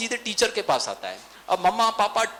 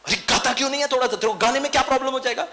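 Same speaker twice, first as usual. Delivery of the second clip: high, loud and fast pushing mum; impatient mother; 8 semitones higher, 5 dB louder, much faster than usual. Retold or imitated. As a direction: rush him, losing patience. Mother forcing son to sing. (झुंझलाई माँ तेज़ ऊँची आवाज़ में)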